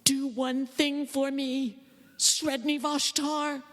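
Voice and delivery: slow, high, hushed plea; boy praying to his hutch god; pleading boy. A narrator's reading. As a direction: beg the ferret god slowly. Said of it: "he asked for a boon".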